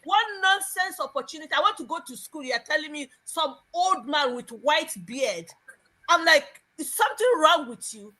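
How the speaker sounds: high, outraged mock reply; indignant high retort. Niece refuses the old suitor, indignant and high. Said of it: "And I said".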